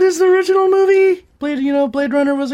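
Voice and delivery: sudden high, loud peak on one word; burst of excitement. Real, not acted. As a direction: jump up on the last word. Excited rising review.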